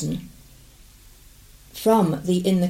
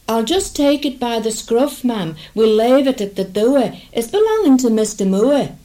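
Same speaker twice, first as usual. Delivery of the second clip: higher, louder country coachman; 4 semitones higher, 5 dB louder than usual. Acted. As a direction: call it out like a coach driver.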